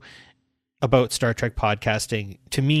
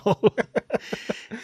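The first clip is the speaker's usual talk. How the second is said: high laugh; 10 semitones higher than usual. Real, not acted. Laughing at own story.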